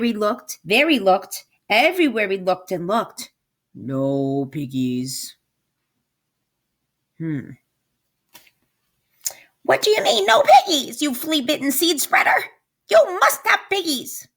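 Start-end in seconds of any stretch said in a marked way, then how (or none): 3.7-7.5 low, slow farmer drawl. Acted. Farmer answering the witch with a slow low voice. 9.6-14.3 high, loud outraged witch; shrieking witch. Acted. Witch shrieking at the farmer who has no pigs.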